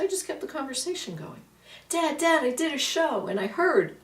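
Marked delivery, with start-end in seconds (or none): 1.9-3.3 excited. Retold or imitated. Calling to her late dad again.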